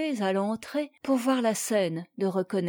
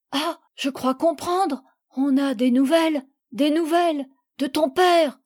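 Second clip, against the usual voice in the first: higher voice, building in volume; dawning excitement; 5 semitones higher, 4 dB louder than usual. A narrator's reading. Mother guessing there is news of father, excited. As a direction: let sudden realization build to a cry.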